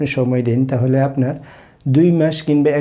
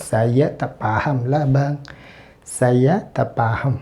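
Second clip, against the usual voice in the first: very slow, careful Malay for learners; slow classroom demonstration; slow teaching demo; 3 dB quieter, much slower than usual. Shown as a demonstration. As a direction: lay each word out for a beginner. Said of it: "teacher demonstrating Malay phrase".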